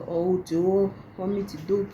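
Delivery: ordinary talk, nothing marked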